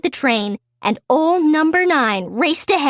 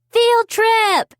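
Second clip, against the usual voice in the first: excited cheer; 9 semitones higher than usual. Acted. Kids hearing about the train race, excited cheer. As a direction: burst out like kids who just heard great news. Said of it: "they cheered excitedly".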